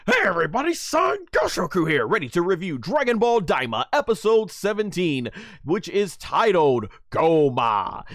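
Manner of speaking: high, loud hyped host greeting; energetic intro retake. Shown as a demonstration. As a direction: go again with the same big opener. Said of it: "Or let me try that over again".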